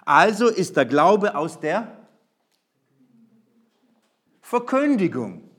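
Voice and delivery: slow, loud, weighty verse read; solemn proclamation. Acted. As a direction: land the decisive verse slowly. Key verse delivered slowly. (langsam und feierlich verkünden)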